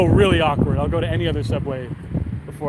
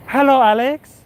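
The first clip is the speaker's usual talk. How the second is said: manager impression; 8 semitones higher, 5 dB louder than usual. Manager phoning a job applicant, high mock voice. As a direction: imitate the manager in a raised pitch. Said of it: "He goes".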